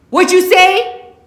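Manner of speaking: very loud, high, slow menacing bark. Acted. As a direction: dare her to repeat it. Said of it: "he barks".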